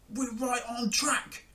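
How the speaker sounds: high, drawn-out crook's voice; slow, high, self-assured villain; thief Hugh. A narrator's reading. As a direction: stretch it out in a put-on thief voice. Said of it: "said Hugh".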